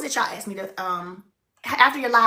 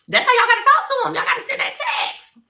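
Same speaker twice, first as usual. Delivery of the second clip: excited urging; 13 semitones higher than usual. Real, not acted. Urging viewers to text family.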